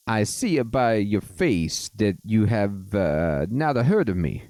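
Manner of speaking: put-on Mario-style Italian accent. Fairy godfather addresses the player in a Mario accent. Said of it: "got the, the Mario accent".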